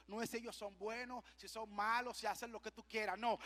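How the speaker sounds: usual speaking voice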